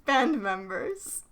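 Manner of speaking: normal talking voice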